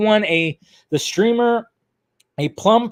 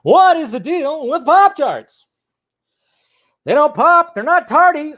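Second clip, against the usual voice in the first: high, loud observational-comic delivery; Jerry Seinfeld bit; 9 semitones higher, 4 dB louder than usual. Retold or imitated. Host riffing on Pop-Tarts as Seinfeld, high stand-up voice. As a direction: ask it like a stand-up setup, pitched way up. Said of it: "That's my terrible Jerry Seinfeld impression".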